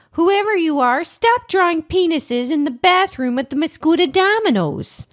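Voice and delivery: high, sustained plea; pleading. Acted. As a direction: appeal to an unknown culprit, pitched well up. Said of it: "with a plea".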